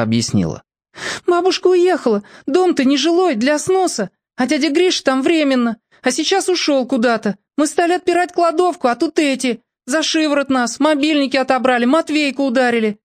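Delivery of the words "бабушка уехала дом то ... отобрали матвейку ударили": put-on breathless girl. A narrator's reading. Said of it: "часто дыша".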